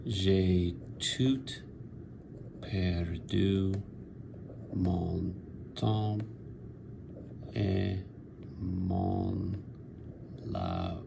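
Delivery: slow recitation of verse. Shown as a demonstration. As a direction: recite "J'ai tout perdu mon temps et mon labour" slowly, word by word, with long pauses. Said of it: "Chaucer's Fortune includes a French line".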